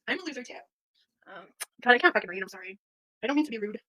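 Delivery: usual speaking voice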